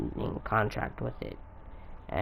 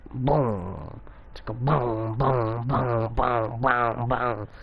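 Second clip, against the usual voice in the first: rapid string of mouthed explosion booms; boom, boom, boom as vocal sound effects; imitated booms; much slower than usual. Storyteller amping up the power a little bit, imitated booms.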